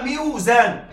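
ميوزان as "miw-zān", w kept; ميوزان with a stretched miw; original root form. Shown as a demonstration. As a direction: sound the waw after the i in ميوزان. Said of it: "فأصلها".

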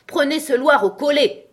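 loud, high furious command; yelling queen. A narrator's reading. Queen ordering the Dormouse seized, yelling. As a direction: yell the order across the court. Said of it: "hurla la reine".

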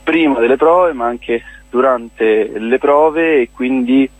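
usual speaking voice

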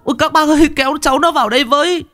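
very high, stammering wail; frantic high-pitched pleading; panicked shriek. A narrator's reading. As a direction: shriek for help, stumbling over words. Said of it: "rú lên".

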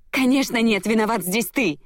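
high, indignant outburst; angry accusation. A narrator's reading. Heroine blaming the man before her, angry accusation. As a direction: hurl the blame back at him. Heroine hurls blame back.

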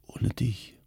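soft, faint words of a dying man; weak whisper. A narrator's reading. Dying husband reassuring his wife, faint whisper. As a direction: breathe it tenderly, barely voiced. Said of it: "flüsterte er".

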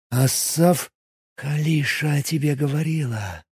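soft, colourless rustling voice of a giant snake. Acted. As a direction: rustle the words like an ancient serpent. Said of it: "прошелестел бесцветный голос".

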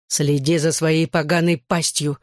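usual speaking voice

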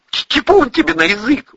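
high, stammering outburst; agitated high-pitched retort; blurted rebuke. A narrator's reading. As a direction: snap back with a stutter. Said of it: "выпалил армеец".

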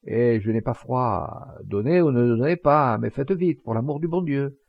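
usual speaking voice

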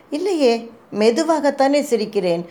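usual speaking voice

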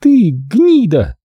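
high, slow, choked insult; strained voice. Acted. Corola spits a slow choked insult. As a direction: squeeze each word out slowly. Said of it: "сказала она сдавленным голосом".

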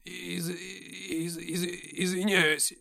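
high, slow, stuttering fear; frightened stammer. A narrator's reading. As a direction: stammer out a scared apology. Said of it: "испуганно запричитал накачанный охранник".

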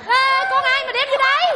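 loud, high-pitched startled cry; alarmed protest. Acted. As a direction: object in alarm, voice shooting way up. Hoảng khi thấy em bé lạ. (la lên hốt hoảng, giọng cao vút)

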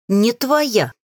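a touch of affronted protest; indignant. A narrator's reading. Witch rebuffing mage's claim on her, indignant. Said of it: "возмутилась я".

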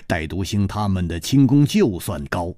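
usual speaking voice

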